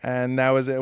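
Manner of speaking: ordinary delivery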